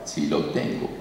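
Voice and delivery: hushed demon voice, barely voiced; quiet demon voice, near whisper. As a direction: let the demon announce itself in a breathy hush. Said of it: "¿Tiene derecho legal sobre esta mujer?".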